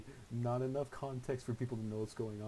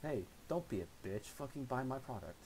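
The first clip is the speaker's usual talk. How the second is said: infomercial voice; 5 semitones higher than usual. Pretend. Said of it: "I sound like some infomercial".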